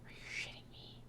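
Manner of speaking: hushed disbelief; exasperated whisper. Real, not acted. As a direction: whisper it flat out.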